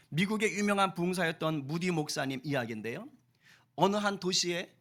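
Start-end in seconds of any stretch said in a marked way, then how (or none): none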